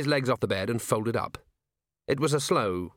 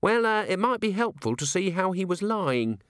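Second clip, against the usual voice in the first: lightly acted higher-pitched junior officer; Littlebottom, earnest junior officer; 8 semitones higher than usual. A narrator's reading. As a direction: explain hesitantly in a higher voice.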